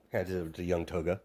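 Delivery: ordinary talk, nothing marked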